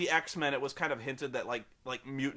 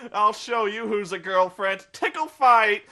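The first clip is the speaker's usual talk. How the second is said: louder, laughing buddy taunt; mock rowdy challenge; 5 semitones higher, 10 dB louder than usual. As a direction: yell it like a playful jock. Shirtless guys start roughhousing.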